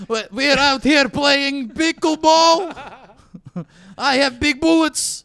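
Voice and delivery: Russian gun voice. Pretend.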